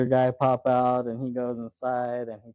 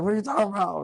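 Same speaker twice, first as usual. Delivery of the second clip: higher-pitched mock bewilderment; retold indignation; 9 semitones higher, much faster than usual. Clerk answering the gunman in a retold film scene, mock indignant. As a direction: imitate a rattled clerk, pitched up. Retells a tense movie argument.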